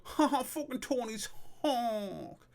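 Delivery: high, slow mocking crow; drawn-out jeer. Lad jeering about Tony's hawk, drawn-out and high. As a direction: stretch the last word long and high.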